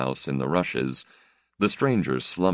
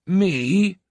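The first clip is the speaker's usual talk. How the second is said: much higher, louder surprise; astonished old priest; 6 dB louder than usual. Acted. Old priest to the stranger, astonished. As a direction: blurt it in disbelief.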